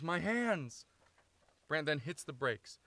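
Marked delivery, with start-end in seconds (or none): 0.0-0.8 raised, loud cry of alarm; scream. Acted. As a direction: cry it out in a panic at the wheel. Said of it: "Brant screams".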